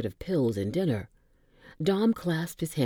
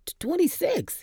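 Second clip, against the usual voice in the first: gushed. Acted. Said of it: "he gushed".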